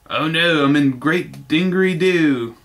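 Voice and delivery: mock Australian, slowing on Great Dingery Doo; Australian accent. Reviewer mocking the Australian dub voices, hammed-up Australian accent. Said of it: "you can start to hear an Australian accent".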